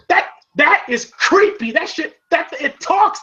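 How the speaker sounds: high-pitched creeped-out grumble; high disgusted voice. Retold or imitated. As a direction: sound creeped out. Voicing disgusted Paulie.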